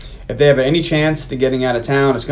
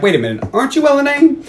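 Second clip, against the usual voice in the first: high, thrilled gasp of recognition; a high, starstruck "wait a minute"; excited recognition; 11 semitones higher than usual. Retold or imitated. The band recognizing the famous singer, high and thrilled.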